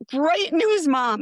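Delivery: high, loud, bursting with good news; excited. Retold or imitated. Telling her mom a terrible diagnosis, overjoyed. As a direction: announce it like a celebration.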